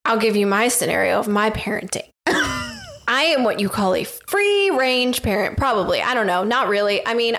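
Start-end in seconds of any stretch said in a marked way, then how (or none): 2.2-3.0 short high burst of laughter. Real, not acted. Laughing before telling her parenting story, high laugh.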